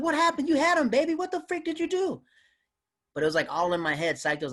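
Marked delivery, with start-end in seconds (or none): 0.0-2.2 very high, louder mock-incredulous; squeaky disbelieving fellow comics; baffled comics. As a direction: comics teasing him after he lost the room, very high. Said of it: "all the comics like".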